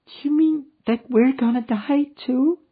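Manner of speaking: put-on high, childlike voice of a small girl; a lost little girl asking after her mother. A narrator's reading. A small girl asking the stranded man for her mother.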